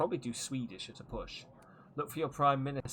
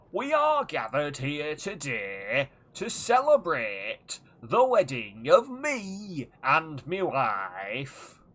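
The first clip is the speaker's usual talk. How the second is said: slow, louder, pompous toast voice with a put-on accent; mock wedding speech voice; 8 dB louder, much slower than usual. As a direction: stretch every word like a grand best man. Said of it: "there's gonna be voices".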